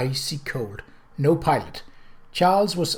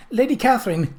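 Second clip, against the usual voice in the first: high, raised call of her name; 10 semitones higher, 4 dB louder than usual. Acted. Journalist running up calls out to Lady Catherine, high and raised. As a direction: hail her from a distance. Journalist calling out across lawn.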